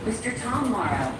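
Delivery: normal talking voice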